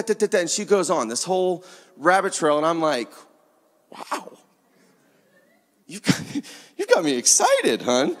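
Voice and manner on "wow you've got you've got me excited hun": delighted chuckle to his daughter; breaking into a laugh of delight. Retold or imitated. Father delighted by daughter's plan.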